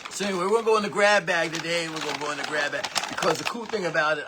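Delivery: loud, fast hyped-up sales pitch; excited pitch. Real, not acted. Hyping the grab bag to viewers, loud excited pitch. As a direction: sell the grab bag with big energy.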